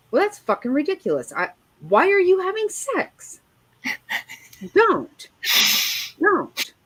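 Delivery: high, exasperated telling-off; indignant scolding. Retold or imitated. Scolding friends about having sex, high exasperation. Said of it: "I had friends that I was like".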